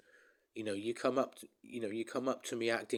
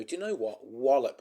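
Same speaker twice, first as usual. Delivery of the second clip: mock threat; 3 semitones higher, 8 dB louder than usual. Imagined punching someone who insults.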